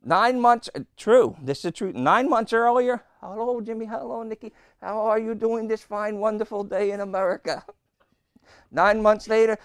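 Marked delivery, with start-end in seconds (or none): 3.2-7.6 sugary politeness, a touch hammed-up; mock sweet greeting. Neighbour's sweet greeting, months earlier.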